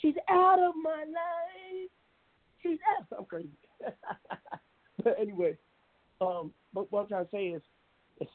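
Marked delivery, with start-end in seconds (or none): 0.0-3.1 high breathy falsetto, slow; [name] impression. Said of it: "I can sound like [name]".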